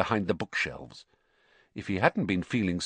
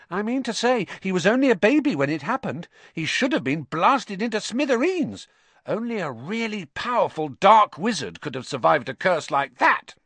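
high-pitched, worked-up schoolboy; insistent Ernie; 11 semitones higher, 4 dB louder than usual. A narrator's reading. Ernie convincing Hufflepuffs Potter is a dark wizard, insistent. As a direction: argue it with rising alarm.

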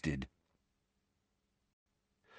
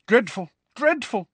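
higher, pompous tut-tutting; 13 semitones higher, 3 dB louder than usual. A narrator's reading. Pompous boy sighing over the news, pompous. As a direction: sound grandly shocked like a self-important schoolboy. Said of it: "shaking his head pompously".

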